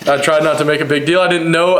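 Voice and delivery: normal talking voice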